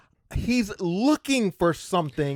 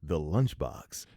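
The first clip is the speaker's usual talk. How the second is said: radio voice. Acted. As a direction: sign off like a radio host. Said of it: "exit the podcast with his radio voice".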